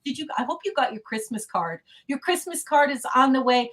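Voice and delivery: ordinary delivery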